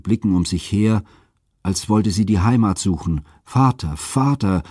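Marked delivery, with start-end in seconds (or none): 3.4-4.0 higher, anguished cry for her father; piercing wail. A narrator's reading. Europa, abandoned on a strange shore, cries for her father in anguish. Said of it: "rief sie mit durchdringendem Wehelaut". 4.0-4.6 higher, anguished cry, drawn out; piercing wail. A narrator's reading. Europa, abandoned on a strange shore, wails for her father again. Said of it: "rief sie mit durchdringendem Wehelaut".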